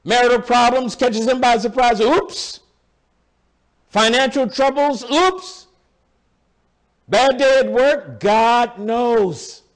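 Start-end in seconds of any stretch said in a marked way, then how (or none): 2.0-2.6 mock oops. Mock surprise at trouble. 5.0-5.7 high squeaky oops; mock oops. Mock surprise at trouble.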